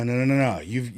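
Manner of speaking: usual speaking voice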